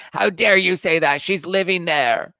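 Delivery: high, loud, slow mock outrage; mocking angry fans. Host imitating fans who got mad at her criticism. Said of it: "People got mad at me for saying".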